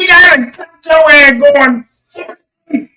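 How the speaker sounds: high, loud retold accusation; hammed-up indignant shout; mock angry cop. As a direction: play an officer hollering at a speeder. Acts officer announcing speeding.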